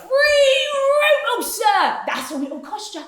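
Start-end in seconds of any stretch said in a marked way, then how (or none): 0.0-2.0 showman's announcement. Acted. Hawker announcing his price.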